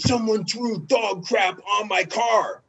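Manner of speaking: high, slow mock outrage; George, upset. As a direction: imitate an aggrieved teammate, drawn out. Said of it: "he was all upset".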